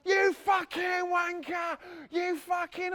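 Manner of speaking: high, slow mock yell of an angry bloke; hammed-up distant yell; retold shout. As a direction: imitate a furious bloke yelling from afar. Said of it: "I heard this bloke".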